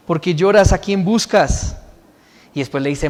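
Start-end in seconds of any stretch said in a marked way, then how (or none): 0.1-1.8 louder, raised-pitch questioning; emphatic question. Acted. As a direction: press the question, drawing out the end. Repite la pregunta con énfasis. (pregunta enfática, en voz alta)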